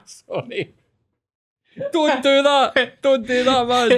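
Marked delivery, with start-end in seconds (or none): 1.9-3.9 high, slow mock scold; exaggerated don't do that; mock telling-off. Real, not acted. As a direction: pretend to tell him off, laughing. Mock scolding after a prank.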